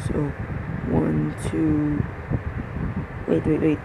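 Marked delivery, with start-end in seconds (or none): none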